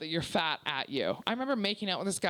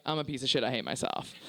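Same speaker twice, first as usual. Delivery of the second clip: low, dejected self-talk; self-loathing; 7 semitones lower than usual. Retold or imitated. Comic talking to herself at home, self-loathing. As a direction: sink down into self-disgust. Offstage self, against confident stage self.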